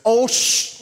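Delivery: loud, high, cut-off curse; acted shocked outburst; imitated alarmed co-pilot. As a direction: start to swear in alarm, stop on the hiss. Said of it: "And he goes".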